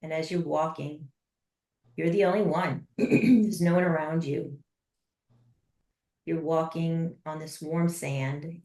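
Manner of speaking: normal talking voice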